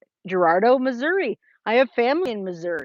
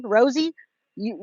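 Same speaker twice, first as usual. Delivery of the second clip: normal talking voice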